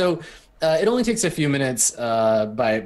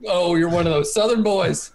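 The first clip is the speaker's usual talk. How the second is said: mock northern sneer; 3 semitones higher than usual. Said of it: "northern vs southern accent".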